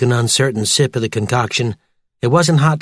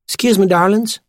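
higher-pitched waitress cutting in; chummy diner waitress; 6 semitones higher than usual. A narrator's reading. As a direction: interrupt sweetly as a diner server. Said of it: "interrupted Brenda, their server".

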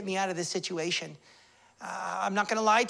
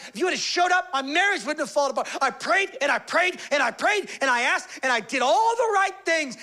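anguished accusation; 5 semitones higher than usual. Acted. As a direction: pour out the hurt at God. Voicing the hurting believer's complaint.

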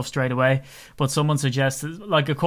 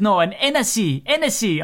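higher, a bit louder mock French accent correcting himself; hammed-up French tourist insisting on Hennessy; 7 semitones higher, 4 dB louder than usual. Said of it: "strong sort of French accent".

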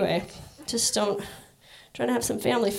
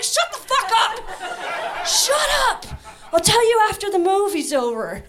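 annoyed hiss; 6 semitones higher than usual. Retold or imitated. Sister scolding her during the film, high and annoyed. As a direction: snap at her to shut up. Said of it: "She's like".

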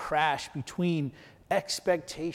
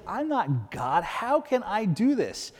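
mildly scared king; 5 semitones higher, slower than usual. Retold or imitated. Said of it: "because he's scared".

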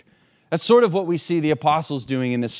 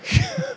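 laugh. Real, not acted. Laughs after story.

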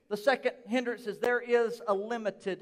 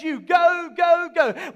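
louder urgent send-off; urging command; 4 semitones higher, 6 dB louder, slower than usual. Retold or imitated. Preacher voicing Jesus sending disciples out, urgent and loud. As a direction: push them out the door. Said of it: "He simply says".

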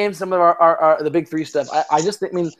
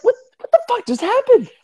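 outraged disbelief. Retold or imitated. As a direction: shout it in shocked disbelief. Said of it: "I was like".

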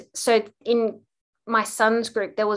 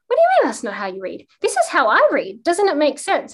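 playfully baffled, higher and louder; mock confusion; 6 semitones higher, 5 dB louder than usual. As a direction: ham up not knowing how to read for the child. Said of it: "pretending to be incompetent at reading".

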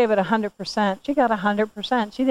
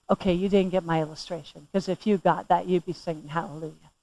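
soft aside; 7 semitones lower, 7 dB quieter than usual. Real, not acted. Drops voice to teasing aside.

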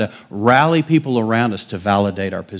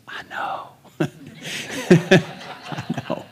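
soft laughing whisper; whispered reply; 6 semitones higher, 5 dB quieter than usual. Retold or imitated. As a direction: breathe it with a chuckle. Pastor's quiet reply, laughing.

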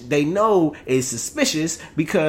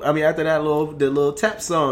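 normal talking voice

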